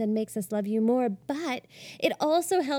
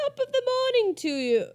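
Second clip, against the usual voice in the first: very high-pitched mock-Irish; badly faked Irish lilt; attempted Irish accent; 13 semitones higher than usual. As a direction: squeak out a hopeless stab at an Irish accent. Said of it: "I can't do Irish".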